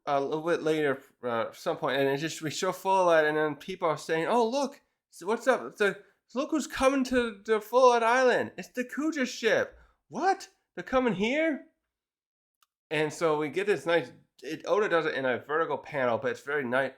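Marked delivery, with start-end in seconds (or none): 4.3-11.7 high-pitched, astonished chatter; excited onlookers. Retold or imitated. Islanders spotting a famous ship coming to their island, excited. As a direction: imitate a crowd's rising disbelief.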